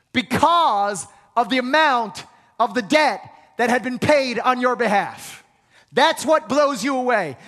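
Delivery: loud, high preacher build; full-force preaching. Acted. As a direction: drive it home to the congregation.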